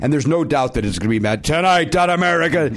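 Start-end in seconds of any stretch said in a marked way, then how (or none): 1.4-2.7 imitating a show host's promo announcement; mock announcer's punchy opener; TV voice-over. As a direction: announce it like a big TV intro. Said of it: "will have a V over".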